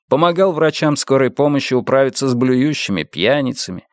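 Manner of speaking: plain reading voice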